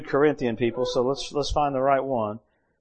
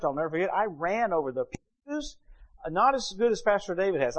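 usual speaking voice

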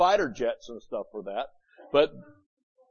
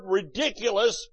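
ordinary delivery

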